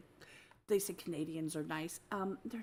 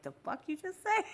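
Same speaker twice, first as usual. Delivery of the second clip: baffled shock; 11 semitones higher than usual. Retold or imitated. Incredulous reaction to slang.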